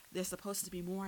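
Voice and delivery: ordinary delivery, nothing marked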